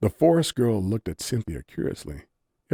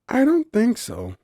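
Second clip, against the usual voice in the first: lamenting; 6 dB louder than usual. A narrator's reading. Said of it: "lamented Nerium".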